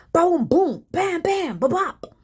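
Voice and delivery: punchy, high sound effects; imitated drum noise. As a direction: act out a loud rock drum kit. Imitates thunderous drumming at night.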